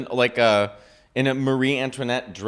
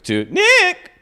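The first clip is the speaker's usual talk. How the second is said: high, loud ghostly wail; 8 dB louder than usual. Acted. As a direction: cry the name like a ghost. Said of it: "I gave".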